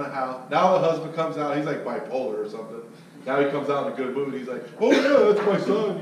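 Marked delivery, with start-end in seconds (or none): 4.8-6.0 much higher and louder, beaming boast; a dad bursting with pride; proud father. Retold or imitated. Husband bragging about his famous son, proud. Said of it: "he's all proud".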